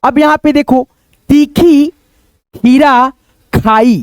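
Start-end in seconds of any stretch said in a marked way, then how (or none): 1.3-4.0 तीखी, खीरा, खाई each said apart, long ई held; stretched word by word. Shown as a demonstration. Teacher reading a sentence to pupils, word by word. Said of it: "हम इसको खींच करके थोड़ा सा पढ़ते हैं".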